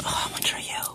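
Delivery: whispered asides to the viewers. Real, not acted. Vlogger confiding to viewers in the store, whispering. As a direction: keep it under your breath so the store can't hear.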